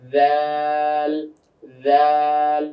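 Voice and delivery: দাল stretched very slowly and evenly; drawn out. Shown as a demonstration. Teacher drilling the class on slow drawn দাল. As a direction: pull দাল out long, unhurried. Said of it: "জিহ্বার আগাটা এসে দাঁতের আগার সঙ্গে লাগবে".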